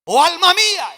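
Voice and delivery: slow, drawn-out plea rising high on the last phrase; preacher acting out David questioning his own soul; imitating David's soliloquy. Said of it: "el que se habla a sí mismo y dice". (soliloquio de David, lento y declamado)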